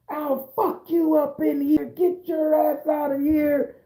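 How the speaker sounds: slow, high-pitched mock tirade; acted angry threat. Judge throwing off his robe to fight, acted fury.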